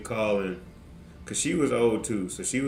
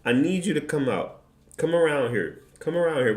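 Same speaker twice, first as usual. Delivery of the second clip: raised pitch, repeated calling-out; mock confrontation; 6 semitones higher than usual. Dad calling the clerk out from the glass, mock confrontation. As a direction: playfully summon someone out for a fight.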